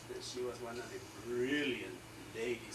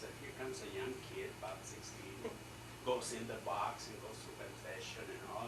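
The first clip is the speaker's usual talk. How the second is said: usual speaking voice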